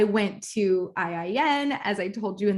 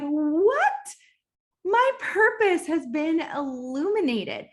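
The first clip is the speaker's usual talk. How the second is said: mock amazement; 7 semitones higher, slower than usual. Acting out her revelation.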